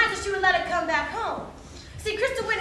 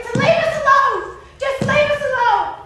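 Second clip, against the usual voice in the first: raised, desperate cry to be left alone; screaming plea; 5 semitones higher, 6 dB louder than usual. Acted. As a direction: cry out, stretching the last plea. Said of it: "Crystal just kept on screaming".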